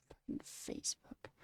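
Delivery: hissed, unvoiced swear; muttered disgust; whispered curse. Real, not acted. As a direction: whisper the curse under breath.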